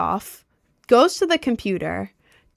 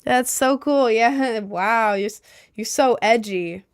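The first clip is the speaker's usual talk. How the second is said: fake enthusiasm; 3 semitones higher, slower than usual. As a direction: play along, humouring him out of fear. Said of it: "So I was kind of being like".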